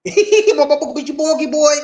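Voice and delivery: high Gullah threat through laughter; laughing mock Gullah kid's threat; Gullah accent. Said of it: "He said".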